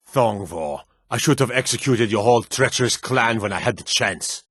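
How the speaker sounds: ordinary talk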